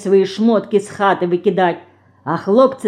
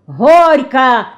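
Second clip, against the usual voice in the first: louder, higher called-out toast; quoted wedding shout; 5 semitones higher, 7 dB louder than usual. A narrator's reading. Федорка quotes guests at a wedding, calling out. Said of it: "гости або родня крикнут".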